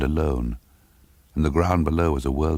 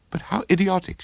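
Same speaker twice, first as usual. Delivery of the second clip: exasperated; faster than usual. A narrator's reading. Character exclaims alone on stairs.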